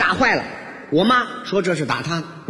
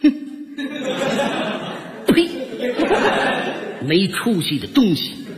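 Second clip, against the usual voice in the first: mock venom from a disgusted mother; spiteful scornful mother; 3 semitones higher than usual. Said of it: "在后边恶狠狠地瞪了我一眼". (老妈恶狠狠地啐骂)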